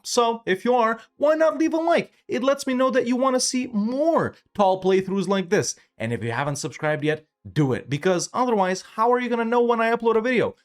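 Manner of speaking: high, bright sales-pitch voice for the like and subscribe ask; channel promo plug. Acted. Youtuber pitching likes and subscriptions to his viewers, upbeat promo read. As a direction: hype up the viewers like a mini ad break.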